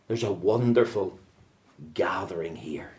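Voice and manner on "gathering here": soft unvoiced whisper. Preacher to the congregation, whispered aside. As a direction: let it fade to a breath. Words fall away to breath.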